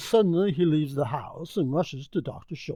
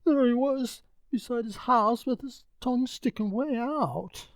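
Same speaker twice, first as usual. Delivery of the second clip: high, breathless servant voice; frightened butler breaking the news; alarmed servant; 8 semitones higher than usual. Acted. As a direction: blurt out the bad news. Servant describes dead dog.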